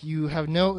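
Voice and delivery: normal talking voice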